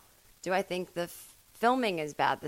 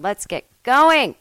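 hyped shout; 6 semitones higher, 11 dB louder, slower than usual. Acted. Podcast host hype opener.

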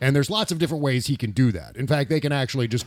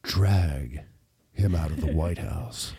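much lower, slow and sultry; sexy voice; 10 semitones lower, 3 dB quieter, slower than usual. Pretend. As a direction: drop way down and make it seductive. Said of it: "I'll do it in, like, a sexy voice".

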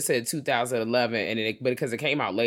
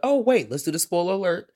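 ordinary talk

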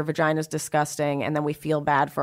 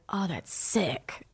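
low drawn-out murmur; 7 dB quieter, much slower than usual. Retold or imitated. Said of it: "I was like".

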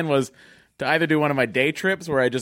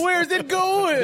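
squeaky high voice; much slower than usual. Pretend. Joking about the passing alarm.

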